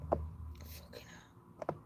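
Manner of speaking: real whispered curse, slow. Real, not acted. Annoyed at a long advert, real slow whisper. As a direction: breathe the curse under your breath.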